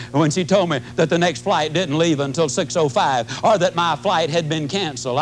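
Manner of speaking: normal talking voice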